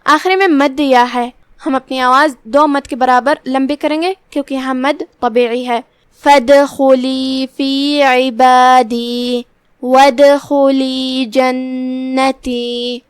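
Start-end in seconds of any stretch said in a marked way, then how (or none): 6.2-13.0 slow melodic Quran chant, long drawn vowels; tajweed recitation. Shown as a demonstration. Teacher recites the verses for the class, slow melodic chant. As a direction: recite each phrase slowly, stretching the madd.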